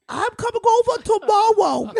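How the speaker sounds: high mock kid voice, r said as w; says w for r. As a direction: imitate a little kid saying r like w.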